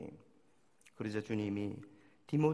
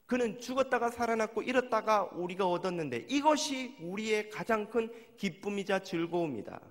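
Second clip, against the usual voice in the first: raised, higher, ringing proclamation of joy; joyful father; 7 semitones higher, 5 dB louder than usual. Acted. Father rejoicing to his elder son, raised joyful voice. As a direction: let the father's joy lift the voice.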